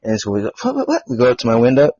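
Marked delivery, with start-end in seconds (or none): 0.6-1.0 high-pitched mock alarm; startled reaction. Reenacting hearing a commotion outside, high startled questioning. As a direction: squeak it out as if startled.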